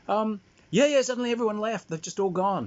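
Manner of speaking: high, loud, mock-oblivious replies; Dad on the phone. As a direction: play a dad calling down the line. Acting out Dad's phone replies.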